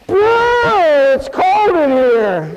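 high shout, slow drawn-out cold; shivering complaint. Retold or imitated. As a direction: shiver it out loud. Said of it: "This is what he does".

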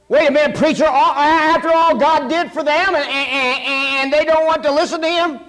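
high, indignant interruption; objecting voice. Pretend. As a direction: protest like a shocked churchgoer. Congregation member objecting to preacher.

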